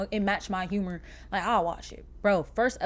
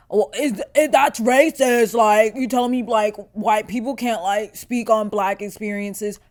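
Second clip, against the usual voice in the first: mock objector; 8 dB louder than usual. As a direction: put on a whiny challenger's voice. You might be asking yourself.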